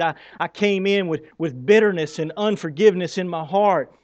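ordinary delivery

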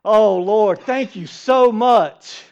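loud, overjoyed thanks, slowing on the thanks; gushing thanks. Retold or imitated. Jonah thrills to God over his shade plant, loud and gushing. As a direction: burst out thanking the Lord at full volume. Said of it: "He's like".

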